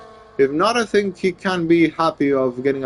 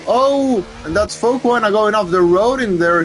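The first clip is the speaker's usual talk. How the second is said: excited race call; 7 semitones higher than usual. Real, not acted. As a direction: call the crash with a jolt. Car goes off, excited call.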